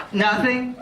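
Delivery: normal talking voice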